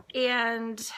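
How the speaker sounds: ordinary delivery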